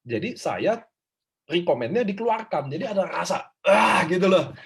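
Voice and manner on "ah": loud rough "ah" burst; brief loud cry to show feeling; short demonstrated yell. Shown as a demonstration. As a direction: let out a quick shout of release. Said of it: "Teriaknya gitu loh".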